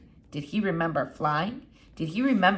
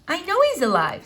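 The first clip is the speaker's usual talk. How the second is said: lightly voiced impatient girl, higher; a touch of a cross young girl; Flora; 10 semitones higher, 5 dB louder than usual. A narrator's reading. Girl insisting to the doctor, lightly voiced and impatient.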